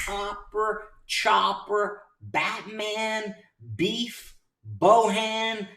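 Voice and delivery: high, drawn-out announcer-like calls; celebratory roll call. Real, not acted. Roll call of winning traders.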